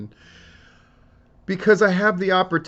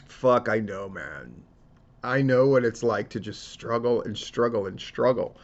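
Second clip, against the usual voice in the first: choked up; 3 semitones lower than usual. Real, not acted. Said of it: "I'm tearing up".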